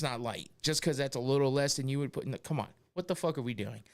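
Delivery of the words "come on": ordinary talk, nothing marked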